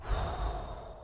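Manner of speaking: long, soft breathy sigh; soft whoosh of release; imitated long exhale. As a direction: let all the air drain out. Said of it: "he just exhaled".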